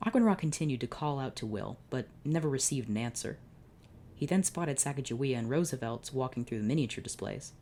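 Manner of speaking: ordinary delivery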